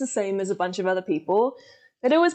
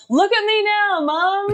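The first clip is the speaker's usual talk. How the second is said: loud gloating boast; triumphant shout; 10 semitones higher, 4 dB louder than usual. Pretend. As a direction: crow it at your mum.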